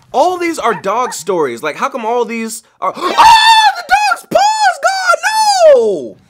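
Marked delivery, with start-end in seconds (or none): 3.0-6.2 high, loud gasping outburst; shocked cry. Real, not acted. Reacting to a three-legged dog, a high shocked cry. Shocked at dog's missing paw.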